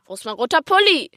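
hoch und laut, schnell durch die Wohnung rufen; lauthals geschrien. Acted. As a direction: ein Kind brüllt nach der Mama. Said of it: "schrie ich lauthals". (Kind ruft laut durch die Wohnung nach der Mama)

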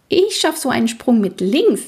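high, cheerful boast; cheerful call. A narrator's reading. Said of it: "rief Fienchen von der Koppel nebenan fröhlich zu". (fröhlich zurufen)

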